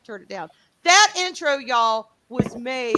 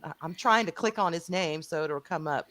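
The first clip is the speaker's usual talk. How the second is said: ordinary talk, nothing marked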